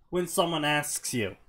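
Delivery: ordinary talk, nothing marked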